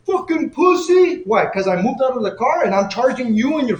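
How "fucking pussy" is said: slow, high, shouted insult; quoting the driver's insult. Retold or imitated. The driver taunting him from the car, slow high shout. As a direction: spit it out slowly as the sneering driver. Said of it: "had the audacity to say".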